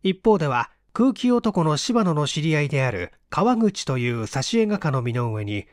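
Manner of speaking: usual speaking voice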